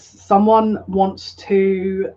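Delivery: very slow, drawn-out and cheesy; cheesy slow delivery. Real, not acted. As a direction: stretch each word out like a corny oracle. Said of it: "That's such a cheesy way".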